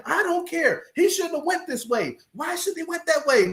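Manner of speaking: high, whiny worried wife voice; complaining wife. Retold or imitated. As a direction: whine it like a wife scared of the storm. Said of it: "She's like".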